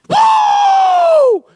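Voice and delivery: high, loud whoop of discovery; shouting in the water over a find; joyful whoop. Retold or imitated. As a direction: let out a huge excited woo. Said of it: "when I'm like".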